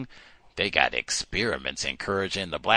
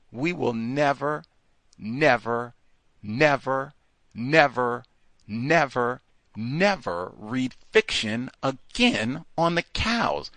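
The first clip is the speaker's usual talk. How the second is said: NEVER repeated as separate punched beats, pitched up; emphatic; 4 semitones higher, slower than usual. Real, not acted. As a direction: hammer each NEVER on its own, then slow down for the rest. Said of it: "saying it with emphasis on my chest".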